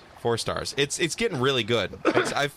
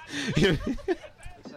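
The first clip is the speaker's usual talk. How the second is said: high burst of laughter; 9 semitones higher, 3 dB quieter than usual. Real, not acted. Laughs at the tease.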